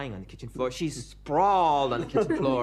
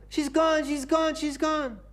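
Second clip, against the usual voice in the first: very high, loud hammed-up lament; mock wailing; 14 semitones higher, 4 dB louder, slower than usual. Professor crying to his student, very high mock wail. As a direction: wail it, overdone grief. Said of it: "He's saying".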